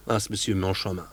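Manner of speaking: ordinary delivery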